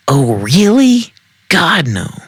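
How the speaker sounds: high, drawn-out whiny objections; exasperated disgust. A narrator's reading. Woman rejecting each thing the man orders, exasperated. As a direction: object in a high, appalled screech.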